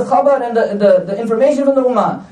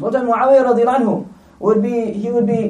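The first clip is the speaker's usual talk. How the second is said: ordinary talk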